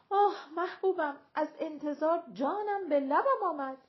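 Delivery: high, loud theatrical lament; pining lover. A narrator's reading. Lover calls to his beloved on the balcony, pining aloud.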